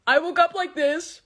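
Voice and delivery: loud, high smug boast; smug neckbeard. Retold or imitated. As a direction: brag like a neckbeard. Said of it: "walking around like".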